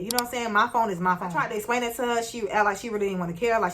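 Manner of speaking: normal talking voice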